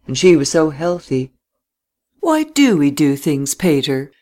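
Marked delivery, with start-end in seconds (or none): none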